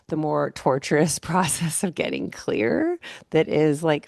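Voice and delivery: ordinary delivery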